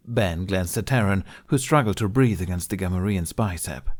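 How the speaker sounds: ordinary delivery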